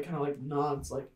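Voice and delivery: ordinary talk, nothing marked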